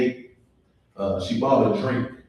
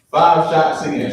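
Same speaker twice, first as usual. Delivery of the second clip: normal talking voice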